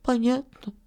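small, high, dejected reply; crestfallen. A narrator's reading. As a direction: answer meekly, spirits sunk. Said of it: "ответил упавшим голосом Филька".

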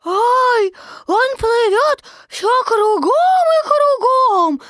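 put-on high, loud, drawn-out child; a small joey worried his stick is lost; squeaky fretful kid; little Roo voice. A narrator's reading. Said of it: "сказал Крошка Ру".

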